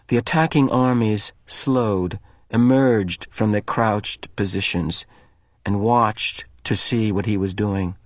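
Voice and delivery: plain reading voice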